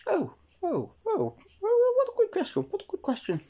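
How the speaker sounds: very high, breathless flustered rabbit; high fluttery rabbit voice; Miss Rabbit. Acted. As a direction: squeak out a flustered delighted rabbit. Miss Rabbit startled, pleased.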